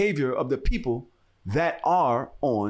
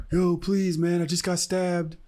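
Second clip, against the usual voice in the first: higher, drawn-out mock plea; imitated pleading; 6 semitones higher, slower than usual. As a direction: plead like a stranger claiming to be hurt. Acts out stranger pleading for help.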